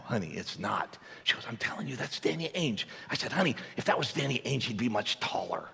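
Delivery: low hushed bickering; husband hushing wife about the stranger; hushed argument. Retold or imitated. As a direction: argue quietly in public. Whispered marital argument.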